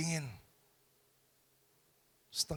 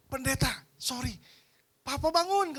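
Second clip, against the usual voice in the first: excited; 8 semitones higher, 5 dB louder than usual. Retold or imitated. Excited son reporting news.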